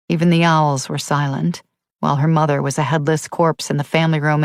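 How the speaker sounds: ordinary delivery, nothing marked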